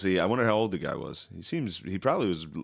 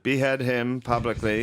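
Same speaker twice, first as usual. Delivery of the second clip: mock royal decree; slower than usual.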